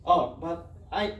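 ordinary talk

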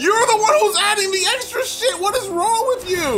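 very high, loud protest; exasperated shout. Real, not acted. Player accused of adding sounds, high exasperated shouting. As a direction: yell back, outraged. Quarrel over who adds syllables.

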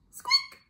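tiny, very high squeak; teeny high-pitched mouse noise. Acted. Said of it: "said Mouse".

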